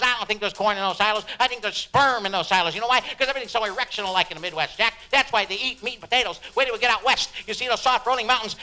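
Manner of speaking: rapid, excited jazz rambler; Neil Cassidy. Acted. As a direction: tumble from thought to thought at Jack. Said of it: "And he said".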